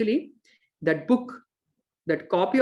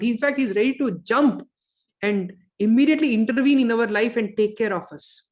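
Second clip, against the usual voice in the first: ordinary talk, nothing marked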